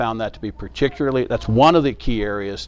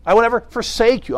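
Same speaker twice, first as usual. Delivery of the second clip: "forsake" pushed out at a raised pitch; stress on FORSAKE; 9 semitones higher than usual. Shown as a demonstration. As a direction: lean hard on FORSAKE, voice held high. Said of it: "emphasizing the different words".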